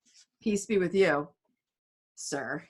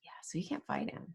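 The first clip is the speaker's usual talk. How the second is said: breathy whispered aside; hushed classroom aside; 12 dB quieter than usual. Real, not acted. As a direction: whisper it like a secret. Explaining a secret marriage.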